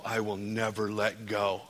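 usual speaking voice

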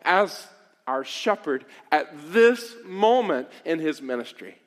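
loud, fervent sermon delivery; rising passionate preacher; preaching. Acted. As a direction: preach it with full force. Preaching at full force.

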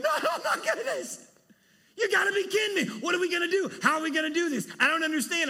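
very high, loud, fast frantic outburst with a laugh; mock panic. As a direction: squeal in mock alarm at the curveball. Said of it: "I'm like going".

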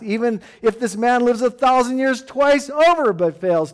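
loud, driving sermon delivery; full-force preaching. Acted. Pastor preaching loudly to his church on rest. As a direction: preach it out to the congregation.

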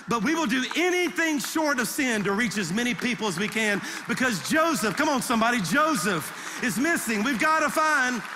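loud, high rallying preacher; punched-out, urgent pulpit cry; preaching climax. Real, not acted. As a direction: drive the congregation to a rousing peak. Preaches at full force.

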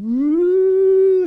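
long high rising "woo"; imitated wind howl. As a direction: howl like wind through cracks. Imitates wind through the house.

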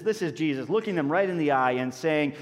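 ordinary talk

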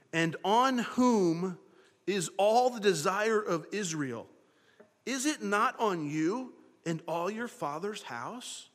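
slow, weighty reading of the verse; solemn scripture reading. Acted. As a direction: lay each word down slowly and gravely. Reads scripture slowly, solemnly.

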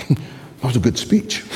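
amused, mocking repeat of speech. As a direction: repeat it with a teasing lift.

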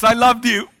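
high, loud hammed-up farewell wail; doomed child farewell to friends; mock goodbye. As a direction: cry out a dramatic goodbye.

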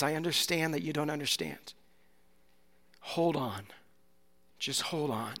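Jesus softly telling a sufferer to hold on; gentle comforting Jesus. Acted. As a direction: lean in and soothe a hurting listener.

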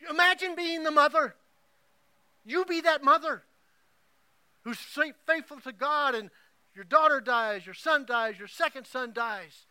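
high, loud preaching push; impassioned preaching. Acted. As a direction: drive it home to the congregation. Preacher appeals to congregation.

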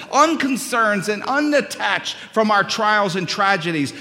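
loud, high sermon delivery; full-force preaching. Acted. Pastor preaching to his congregation at full force. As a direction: drive it home from the pulpit.

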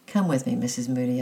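plain reading voice

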